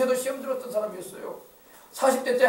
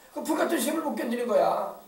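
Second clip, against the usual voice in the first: normal talking voice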